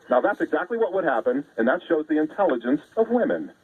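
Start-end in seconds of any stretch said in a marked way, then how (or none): none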